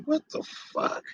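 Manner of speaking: breathy high disbelief; 1 shocked disbelief. Retold or imitated. Retelling his reaction to the accusations, stunned. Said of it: "I'm like".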